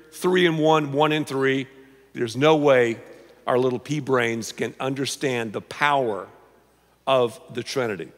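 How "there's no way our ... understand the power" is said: usual speaking voice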